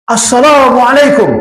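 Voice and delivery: drawn-out, high-pitched call; acted shout. Ex-guard hailing a big temple statue, shouted greeting. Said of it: "dia teriak, Pak".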